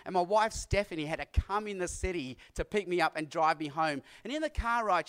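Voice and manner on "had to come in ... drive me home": ordinary talk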